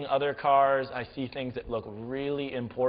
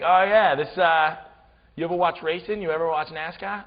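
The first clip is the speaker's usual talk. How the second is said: loud, high, cocky New Englander; New England accent; 5 semitones higher, 7 dB louder than usual. Retold or imitated. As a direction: brag like a New England car guy. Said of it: "had the best accent ever".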